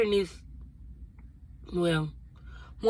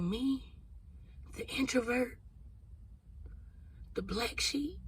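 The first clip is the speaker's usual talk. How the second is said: barely voiced, far softer, awed; hushed disbelief; 16 dB quieter than usual. Telling viewers how she answered God, in a hushed awed voice. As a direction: whisper it as if you can't believe it's you.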